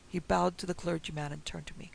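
ordinary delivery

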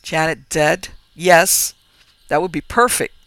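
loud, bitter outburst. Acted. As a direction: let the bitterness burst out loud. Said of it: "Bitterness poisoned his spirit".

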